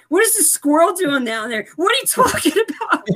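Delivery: disbelieving outburst; mock baffled shriek; incredulous laugh. Incredulous at squirrel.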